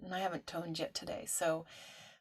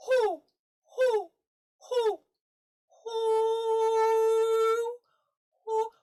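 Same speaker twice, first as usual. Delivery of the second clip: very high, loud hoots, growing longer; yawning, wide-throated owl hooting; 12 dB louder, much slower than usual. Shown as a demonstration. Teacher hooting, yawning, laughing all at once, owl hoot. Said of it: "hooting like an owl".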